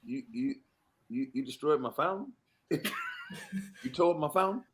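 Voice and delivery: hushed, stunned stammer about his fountain; stunned quiet disbelief. Retold or imitated. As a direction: barely get it out, aghast.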